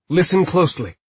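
firm instruction. A narrator's reading. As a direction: demand full attention. Hode demands attention.

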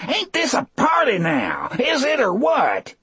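very high, manic yelling voice; screaming, gleeful taunt. A narrator's reading. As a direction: crow wildly while attacking the guards. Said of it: "Still screaming and laughing".

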